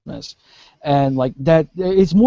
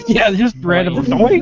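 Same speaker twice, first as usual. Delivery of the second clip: usual speaking voice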